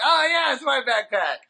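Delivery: high, bright-pitched mock realization; hammed-up "oh, that's mine!"; sudden realization. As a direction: blurt it out like it just dawned on you. Cartoonish realization in retold story.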